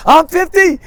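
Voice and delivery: high, loud blurt, words tumbling out. Retold or imitated. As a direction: blurt it out without thinking. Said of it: "And I blurt out".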